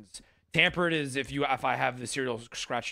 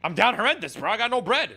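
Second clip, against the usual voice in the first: loud plea; 8 semitones higher, 6 dB louder than usual. Acted. Begging for money, repeated plea.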